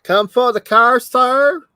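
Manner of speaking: high, loud imitation with a rough English accent; almost-English accent. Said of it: "It's almost an English accent".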